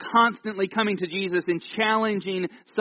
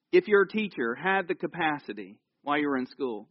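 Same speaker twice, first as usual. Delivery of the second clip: normal talking voice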